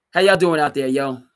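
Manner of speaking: loud, high "How y'all doing out there, yo"; hype greeting. Shown as a demonstration. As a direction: shout it to the crowd with a yo. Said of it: "I didn't need the yo".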